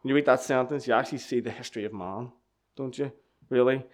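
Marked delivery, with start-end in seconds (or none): none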